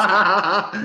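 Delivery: a real high laugh bursting out; burst of laughter. Real, not acted. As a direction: laugh out loud at the joke. Laughing at the retold slip.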